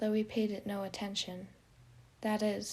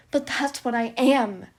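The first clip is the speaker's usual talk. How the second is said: loud, higher anguished shout; 4 semitones higher, 14 dB louder than usual. Acted. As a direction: hurl the words out in self-hatred. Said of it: "Janice shouted".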